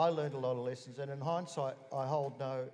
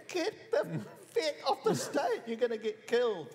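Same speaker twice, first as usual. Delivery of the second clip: high, loud laughing yell to get off the stage; retold yell; 11 semitones higher, 7 dB louder than usual. Man on stage yelling at a cameraman, a high, loud, laughing retold shout. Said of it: "I said".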